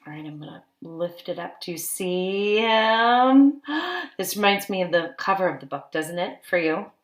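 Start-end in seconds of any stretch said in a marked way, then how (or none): 3.6-4.1 sharp delighted gasp. Acted. Teacher reveals the hidden picture with a delighted gasp. Lifting flap reveals elephant.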